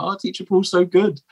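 mock admiring parent, pitched high; gushing parents. Teacher mimicking parents filming his class, mock gushing.

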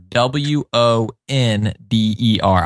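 letters spelled out one by one, slowly; slow spelling out. Shown as a demonstration. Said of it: "spelling out".

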